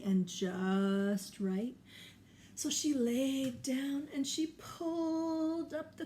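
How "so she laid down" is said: usual speaking voice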